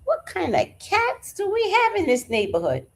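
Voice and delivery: high, startled question; woman hearing cats fighting, high and startled; alarmed disbelief. Retold or imitated. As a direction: sound shocked at the racket. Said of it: "And I was like".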